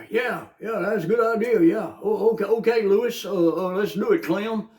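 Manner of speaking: playing a schemer who agrees too fast; mock politician chatter.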